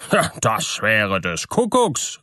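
miller's amazed outburst; astonished exclamation. A narrator's reading. Miller hears of hidden wine, exclaiming in surprise. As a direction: exclaim it, mildly astounded. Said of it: "rief der Müller".

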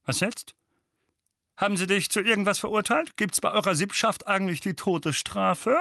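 high, loud, quick jeering uncle; hope for the worst out loud; spiteful uncle, eager for bad news; hopeful sneer. A narrator's reading. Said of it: "fügte er hoffnungsvoll hinzu".

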